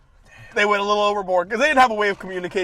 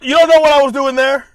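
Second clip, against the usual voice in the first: defensive; 6 semitones higher, 9 dB louder than usual. Retold or imitated. Talking back to AI notes.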